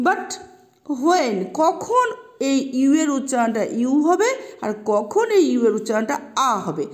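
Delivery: ordinary talk, nothing marked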